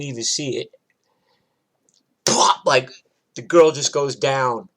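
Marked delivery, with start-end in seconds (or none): 2.2-2.6 loud, abrupt plop; punch sound. Retold or imitated. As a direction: imitate a punch landing, loudly. Imitating the punch landing.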